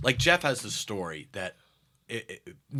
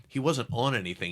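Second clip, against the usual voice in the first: ordinary delivery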